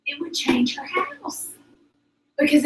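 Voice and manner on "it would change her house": hushed aside, breathy; a secret whispered to the room. Real, not acted. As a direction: lean in and whisper it.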